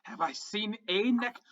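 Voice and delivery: high, scornful goblin voice; goblin necromancer. Acted. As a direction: sneer like an offended little goblin. GM holds the goblin voice.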